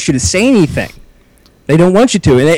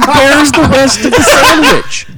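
high, slow, laughing outrage; mock the wife's disbelief, laughing; outraged mock demand; 9 semitones higher, 3 dB louder, slower than usual. Telling friends about his wife's restaurant outburst, high laughing outrage. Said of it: "She said".

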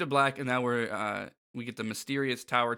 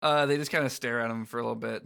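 usual speaking voice

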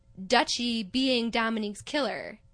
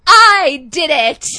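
much louder, slow, drawn-out confession; dramatic confession; 2 semitones higher, 16 dB louder, slower than usual. Retold or imitated. Dutchie bursting in to confess the murder, hammed-up shout. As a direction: belt it like a soap-opera reveal. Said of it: "Dutchie enters and goes".

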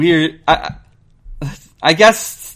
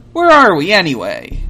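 high hero voice; 3 semitones higher, 6 dB louder than usual. Acted. Sonic looks around.

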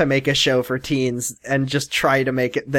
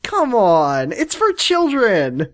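exasperated; 7 semitones higher, much slower than usual. Real, not acted. Exasperated protest.

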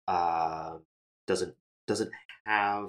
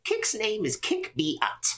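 much higher creature voice; Kick voice; 14 semitones higher than usual. Acted. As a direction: squeak out the name proudly. Kick introduces self third person.

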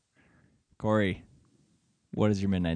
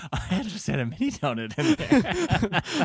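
laughing; 9 semitones higher, slower than usual. Real, not acted. Laughs at the good bite.

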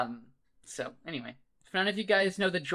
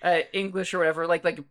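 usual speaking voice

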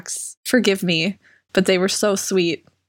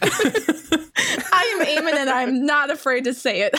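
mock proud declaration; 5 semitones higher than usual.

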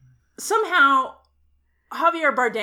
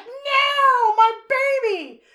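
high, loud, slow wail; anguished cry; 14 semitones higher, 7 dB louder, much slower than usual. Retold or imitated. Retelling the film, a mother screams for her baby, high and loud. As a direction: howl it like a mother losing her child. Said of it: "and she's like".

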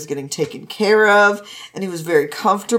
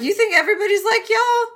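mocking scoff; 6 semitones higher than usual. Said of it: "Wei Wuxian's like".

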